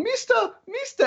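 very high-pitched mimicked call; pleading movie lady. Movie lady calling to a man, high mock plea. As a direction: imitate a lady calling out in falsetto. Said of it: "all I can picture is in Happy Gilmore".

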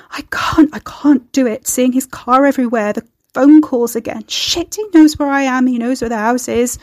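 rising panic. A narrator's reading. Carrie frightened that her ex is tracking her, panicked. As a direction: spill it out in mounting alarm.

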